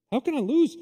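high, loud disbelieving cry; rising incredulous shout of confidence; incredulous exclamation. Retold or imitated. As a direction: throw it out as if losing were impossible. David's question repeated, much higher.

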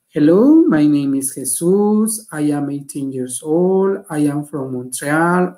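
slow, careful English model; modelling the sample. Shown as a demonstration. Teacher models the introduction again, slowly. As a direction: say it slowly so they can copy it. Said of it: "ahí tienen un ejemplo".

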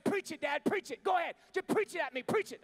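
a fed-up son snapping preach it at me; defiant mock challenge. Mocking dad, retold.

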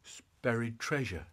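small, soft admission; sheepish confession. Acted. Boy explaining himself to dad, sheepish. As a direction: own up quietly after a long pause. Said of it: "And I said".

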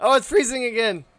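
loud, high, exasperated cry; frustrated outburst. Real, not acted. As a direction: yell it at the frozen screen. Game freezes mid battle.

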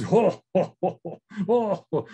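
big belly laugh. Acted. Wise old spirit laughing at hunters from the mountainside, belly laugh. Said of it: "this big belly kind of laughter".

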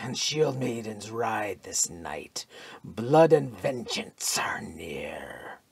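slow, breathy whispered prophecy; ominous whisper. A narrator's reading. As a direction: whisper it like a seer's warning.

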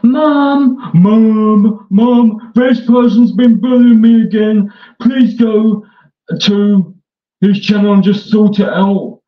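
hammed-up crybaby begging mom for help; whiny kid calling mom. Mimics rival whining to his mom.

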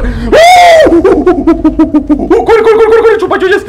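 high frantic shouting run; excited yell. Real, not acted. Streamer yells at chasers in the game, frantic high shout. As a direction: yell at them to run, panicked and thrilled.

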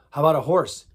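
normal talking voice

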